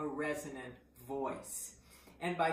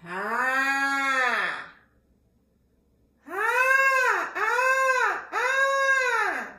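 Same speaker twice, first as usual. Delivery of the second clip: high, loud open "ah" cry; bird call; 12 dB louder, much slower than usual. Shown as a demonstration. Voice teacher leading a warm-up, loud high bird calls. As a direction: send out piercing bird cries. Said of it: "Finding your own bird".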